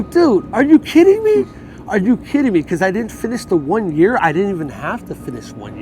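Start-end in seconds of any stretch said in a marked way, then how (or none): 0.0-2.6 high, loud disbelieving yell; retold outrage. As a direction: yell your disbelief at the court.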